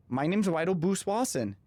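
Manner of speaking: "Weidel Boos Wasson" with w for r and l; toddler pronunciation. Retold or imitated. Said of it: "It was muffled and it was very awkward".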